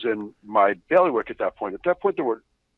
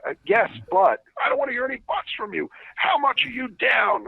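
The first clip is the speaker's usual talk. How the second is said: high, raised mock yell of an angry boss. Boss yelling at the trader about his long position. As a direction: shout it up high like a furious boss.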